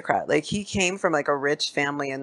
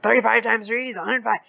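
quick numbers; 4 semitones higher, faster than usual. Retold or imitated. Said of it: "spits out numbers really fast and hard".

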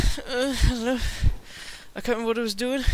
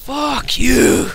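angry; 5 semitones higher, 9 dB louder than usual. Real, not acted. Angry outburst at enemy player.

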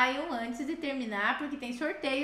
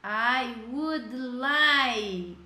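"I would like" drawn out slowly; slow recap; much slower than usual. Shown as a demonstration. Teacher repeating the key phrase for students, slow. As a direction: stretch "I would like" one last time. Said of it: "o que pega aqui é a pronúncia".

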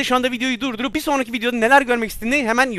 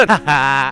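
short laugh; 8 semitones lower, 3 dB louder than usual. Real, not acted. Laughs at granny failing.

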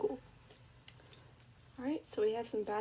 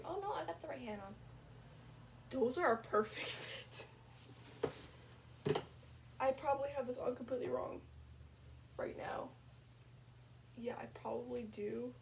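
whispering; 6 dB quieter than usual. Real, not acted. Whispering while dressing.

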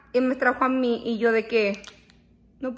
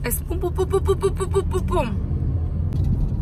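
rhythmic pum; 6 semitones higher, slower than usual. Acted. Imitating a rushed rhythm.

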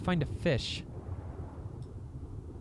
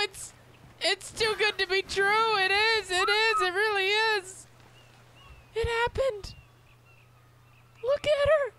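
overjoyed squealing; 13 semitones higher, much slower than usual. Real, not acted. Gamer finding a beloved otter again, high ecstatic squeals. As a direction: gush like a dream came true.

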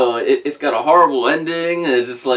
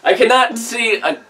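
much higher, slow, pleading with a laugh in it; whiny actor complaint; 7 semitones higher, slower than usual. Retold or imitated. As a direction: beg the director like a sun-blinded actor. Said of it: "you can see him going like".

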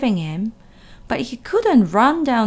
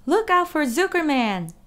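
higher gander yell; 7 semitones higher than usual. A narrator's reading. Gander yelling warning to Wilbur, higher. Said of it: "yelled the gander".

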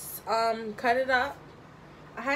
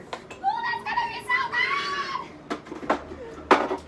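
very high delighted squeal; excited squeal. Real, not acted. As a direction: squeal with joy over the food. Anticipating the buttered cornbread.

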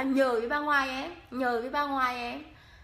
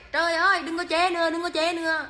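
louder, higher playful protest; louder playful complaint to the teasing viewers; mock protest; 4 semitones higher, 6 dB louder than usual. Real, not acted. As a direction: beg them to stop teasing, half laughing.

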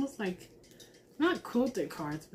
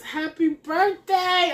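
1 excited; 8 semitones higher, 7 dB louder, slower than usual. Real, not acted. Reading the card excitedly.

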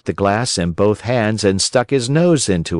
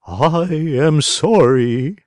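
high, slow and prim; fussy owl doctor; 7 semitones higher, slower than usual. A narrator's reading. Owl doctor politely contradicts the crow, high and prim. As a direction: apologise with prim owl dignity.

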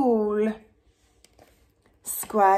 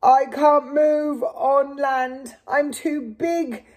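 moaning; 4 semitones higher than usual. Acted. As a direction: drag it out, helpless. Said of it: "moaned the whale".

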